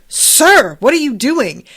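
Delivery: loud, high call to her superior; calling out alarmed. Retold or imitated. Hawkeye calling after Roy as he advances, loud alarm. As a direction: shout to stop him. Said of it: "Hawkeye is like".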